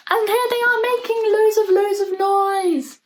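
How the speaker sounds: high and loud, bursting with glee; excited. Real, not acted. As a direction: gasp and gush about the noise. Gasps, excited about noise.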